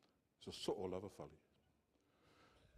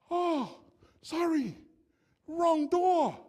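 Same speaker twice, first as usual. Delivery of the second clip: put-on high, loud falsetto old lady apologising; sheepish old lady; 14 dB louder, much slower than usual. Preacher imitating the old lady at his door, high sheepish falsetto. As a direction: squeak it out like a flustered little old lady. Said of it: "sheepishly says".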